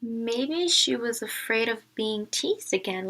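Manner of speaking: normal talking voice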